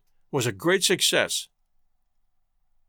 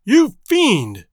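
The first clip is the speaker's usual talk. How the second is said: high, loud outraged cry; furious yell; 4 semitones higher, 6 dB louder than usual. A narrator's reading. Agent denounces Makola for selling men, yelling. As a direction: yell it out as an accusation. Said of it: "he yelled out".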